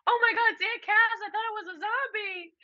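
put-on high, shrieking kid voice; high mock shriek; imitating cousin. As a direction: squeal like a startled kid. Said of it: "Monica was like".

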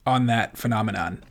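usual speaking voice

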